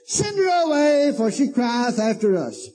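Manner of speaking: high, slow, bold demand; boldly. Retold or imitated. Disciples boldly demanding Jesus send her away, high. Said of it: "I mean, just boldly".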